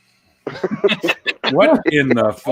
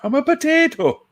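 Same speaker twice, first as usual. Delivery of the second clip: silly high voice. Pretend. Repeating the silly potato line.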